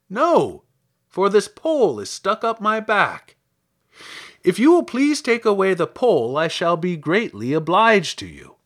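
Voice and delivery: high, loud scarecrow voice; earnest scarecrow. Acted. Scarecrow asks girl to take away the pole, earnest.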